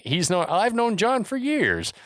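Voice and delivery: high, drawn-out mock disbelief; acted baffled objection; disbelieving. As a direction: protest slowly, pitch climbing high. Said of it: "Beller still can't wrap his mind around it".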